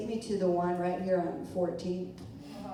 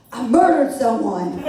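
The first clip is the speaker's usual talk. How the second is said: sudden loud, higher outburst; mock confession shout; 5 semitones higher, 11 dB louder than usual. Arrested woman answering the shocked nurse, shouting. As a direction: blurt it out at the top of your voice for the laugh.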